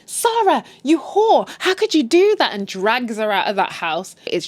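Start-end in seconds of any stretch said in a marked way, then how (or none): 0.0-2.5 high, loud scolding; mock outraged mother. Upset mother confronts Sarah, mock outrage. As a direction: act out a mother yelling in outrage. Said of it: "her mother, is upset".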